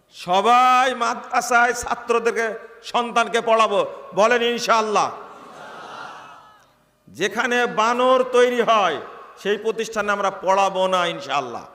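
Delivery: fiery sermon call to the faithful; rallying preacher. Real, not acted. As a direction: whip the crowd into answering. Preacher rallies the crowd.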